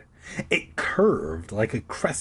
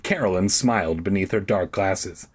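ordinary delivery

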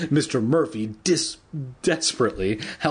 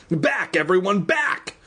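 shrieked; 7 semitones higher than usual. A narrator's reading. Teacher ordering everyone back from a monster hamster, mild shriek. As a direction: call out high in alarm, lightly acted. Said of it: "Mister Murphy shrieked".

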